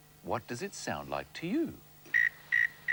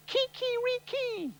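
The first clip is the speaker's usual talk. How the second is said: high slow kiki-riki crow; rooster crow; slower than usual. Retold or imitated. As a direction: stretch it out like a rooster. Spanish rooster call.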